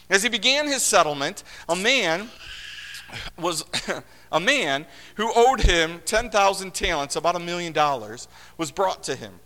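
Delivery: a loud, formal reading of the parable; scripture reading. Acted. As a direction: read the passage out to the congregation.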